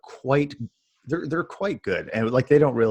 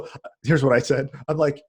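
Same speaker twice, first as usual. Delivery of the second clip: ordinary delivery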